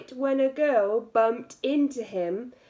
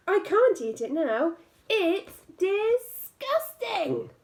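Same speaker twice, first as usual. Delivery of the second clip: high, slow, disgusted frog voice; fussy frog; 7 semitones higher than usual. Acted. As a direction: stretch out disgusting like a squeamish frog. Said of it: "said the frog".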